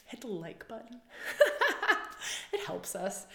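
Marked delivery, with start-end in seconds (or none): 1.1-2.5 a high, airy giggle; high laugh. Real, not acted. To her viewers after asking for a like, giggling. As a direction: burst into a light giggle.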